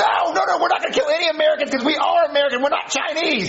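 high, hurried pleading protest; frantic denial. Acted. Accused man denying he'd kill Americans, frantic denial.

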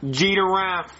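"Giraffe" as "jee-duh-raff"; low "Giraffe" with an extra D; mispronounced giraffe. Acted. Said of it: "There's no D".

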